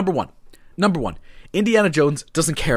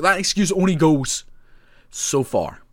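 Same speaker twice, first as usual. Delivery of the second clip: usual speaking voice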